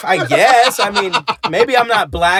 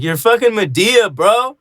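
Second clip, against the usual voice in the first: incredulous; 8 semitones higher than usual. Retold or imitated. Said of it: "I was like".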